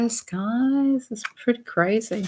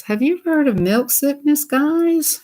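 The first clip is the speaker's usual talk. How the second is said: asked much higher, stress on "heard"; high questioning; 8 semitones higher than usual. Real, not acted. Asking viewers about the phrase, high and incredulous. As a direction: lift it up in disbelief to the viewers. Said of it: "Listen to those words".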